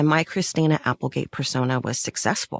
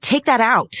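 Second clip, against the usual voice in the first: raised, higher voice with a laugh breaking in; firm demand; 7 semitones higher, 4 dB louder than usual. Retold or imitated. Telling a dear friend to take the name out of her phone, raised and firm. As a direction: insist sharply, half laughing at it. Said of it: "I said".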